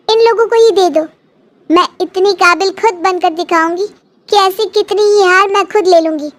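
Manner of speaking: put-on defiant young daughter. Daughter defies her grandmother.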